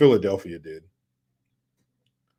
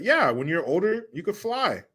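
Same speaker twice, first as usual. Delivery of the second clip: mocking; 7 semitones higher than usual. Mocking the dad's advice.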